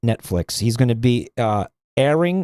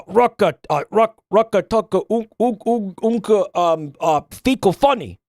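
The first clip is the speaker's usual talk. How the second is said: mock fake dub language; 4 semitones higher than usual. Streamer mocking a foreign dub of Joel, hammed-up gibberish. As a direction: babble nonsense as a hammed-up dubbed Joel. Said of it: "I made up a fake language".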